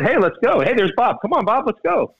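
high, eager call to join; calling Bob over. Retold or imitated. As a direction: wave him over with a bright call. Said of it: "That's why they said".

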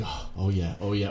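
quiet mock moaning; low, soft mock groan. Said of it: "you'd be like".

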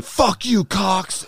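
sudden high mock yell at the teller; angry retort. Billy yelling back at the teller, angry high. Inmate snapping back at insult.

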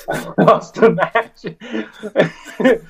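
can't get the words out for laughing; real laugh cracking the line into a high squeal; laughing through it. Real, not acted. Laughs retelling his childhood crying.

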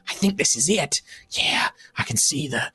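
hushed thief whisper; whispering young thief. Acted. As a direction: breathe it out secretly. Thief whispers finding the vault.

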